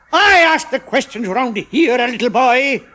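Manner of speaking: loud, slow, high sneer; leering chemist. A narrator's reading. Chemist leering at Ronnie, loud and slow. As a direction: lean in menacingly. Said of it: "The chemist leered".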